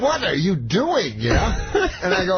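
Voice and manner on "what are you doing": higher, slow, drawn-out question; lightly mimicked woman, drawing the words out; Barbara Walters. Interviewer questions comic's fake phone call, lightly mimicked. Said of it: "So then Barbara Walters goes".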